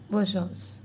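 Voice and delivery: soft repeat. Shown as a demonstration. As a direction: echo it quietly. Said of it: "Good luck is bonne chance".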